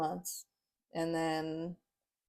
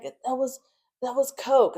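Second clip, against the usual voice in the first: defensive excuse; 6 semitones higher, 3 dB louder, slower than usual. Retold or imitated. Husband making excuse, retold.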